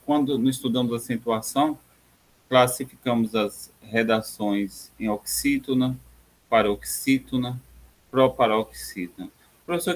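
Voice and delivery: ordinary talk, nothing marked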